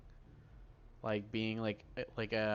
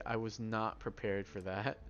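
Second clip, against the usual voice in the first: ordinary delivery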